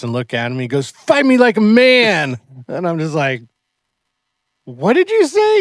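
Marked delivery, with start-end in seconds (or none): 0.9-2.4 put-on loud, high, drawn-out kid's challenge; mock yelled challenge. Little Jackson challenges his sparring partner, mock yell. 4.6-5.6 very high, loud startled question; mock incredulous. Coach reacts to the kid's challenge, mock incredulous. Acted startled reply to kid.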